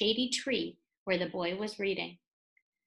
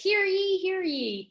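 town crier; 8 semitones higher, 4 dB louder than usual. Acted. Reading crier's sign.

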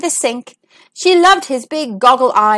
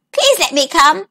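a lightly voiced, slightly higher frog; 3 semitones higher than usual. A narrator's reading. Frog offers to fetch the princess's ball, light hopeful frog voice. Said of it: "said the frog".

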